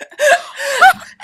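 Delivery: high, loud imitated gasp; winded gasp. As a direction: gasp as if the air got knocked out. Acts out being winded.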